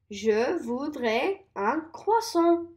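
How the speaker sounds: "je voudrais un croissant" said slowly; slow repeat-after-me; slow model. Shown as a demonstration. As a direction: break the order down slowly. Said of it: "so you know how to say it".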